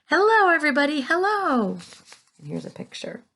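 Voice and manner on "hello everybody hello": high, loud cheerful calling. A narrator's reading. James greeting the crowd from the peach. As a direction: wave and call down happily. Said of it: "laughing and waving and calling out".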